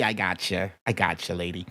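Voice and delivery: low soft aside to a viewer; quiet aside. Real, not acted. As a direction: murmur it off to the side.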